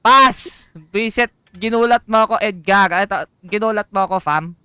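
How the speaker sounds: loud, high shaken yelling; startled shouting. Real, not acted. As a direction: yell right after a scare. Startled by vendor Edgar.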